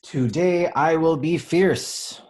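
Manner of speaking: rousing read-aloud of the refrain; bold proclamation. A narrator's reading. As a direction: declare it like a brave heroine.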